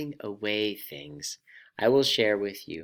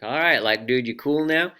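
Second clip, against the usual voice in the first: lightly put-on surfer dude, louder; casual surfer talk, louder and rising in pitch; 4 semitones higher, 8 dB louder than usual. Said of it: "I'll try my best surfer voice".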